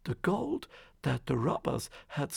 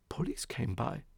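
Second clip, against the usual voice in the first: usual speaking voice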